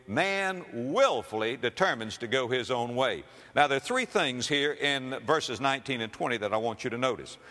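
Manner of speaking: normal talking voice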